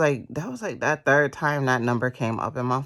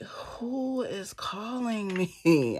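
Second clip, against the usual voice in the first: puzzled drawl; 8 semitones higher, much slower than usual. Retold or imitated. Repeats confusion, drawn out.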